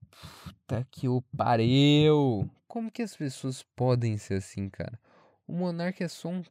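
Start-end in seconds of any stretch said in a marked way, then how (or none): 0.1-2.5 slow, drawn-out exasperated curse; exasperated swearing. Real, not acted. Fed up with Twitter complainers, exasperated. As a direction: stretch the curse out in disbelief.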